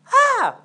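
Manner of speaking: very high, loud mimicked child shriek; toddler scream. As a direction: scream back like a disobedient toddler. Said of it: "stop screaming".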